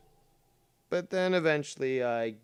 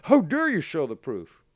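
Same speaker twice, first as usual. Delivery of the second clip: higher, louder hammed-up indignation; mock outrage; 5 semitones higher, 8 dB louder than usual. Streamer parodying critics angry at his proof, mock outrage. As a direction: parody their offended gasp.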